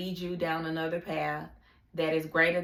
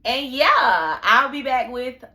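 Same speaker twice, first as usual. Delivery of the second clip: much louder and higher, slow and drawn out; loud happy sign-off; 7 semitones higher, 12 dB louder, slower than usual. Real, not acted. Signing off to her nation crew, loud and slow. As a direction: call it out big to the fans. Excited outro to viewers.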